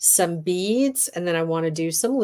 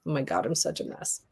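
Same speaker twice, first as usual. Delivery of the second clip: ordinary talk, nothing marked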